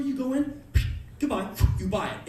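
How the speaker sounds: ordinary talk, nothing marked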